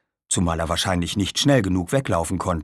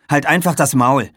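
loud, raised, forceful command; angry order; 6 semitones higher, 6 dB louder than usual. Acted. Aaron tells his friend to shut up, angry order. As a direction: order them rudely to stop. Aaron silences the quarrelling boy.

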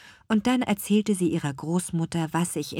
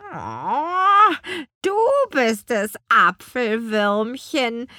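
creaking crone voice, much higher and louder, slowing down; creaky old witch; 6 semitones higher, 6 dB louder, much slower than usual. A narrator's reading. Grandmother witch greets her granddaughter, creaking. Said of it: "knarzte sie".

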